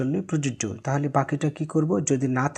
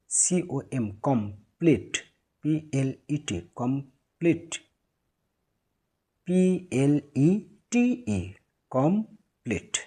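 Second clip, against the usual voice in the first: slow spelling out; slower than usual. Shown as a demonstration. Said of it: "syllable ভেঙে ভেঙে পড়লে".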